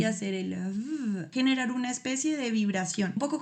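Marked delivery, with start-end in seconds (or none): 0.5-1.3 a soft, slow vibrating v; v vibrante; buzzing v. Shown as a demonstration. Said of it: "poner como los dientes en el labio inferior".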